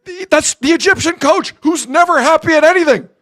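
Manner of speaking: high, urgent commentary; excited play-by-play. Real, not acted. Commentator pointing out the Egyptian coach, excited calling. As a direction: keep the call pitched right up.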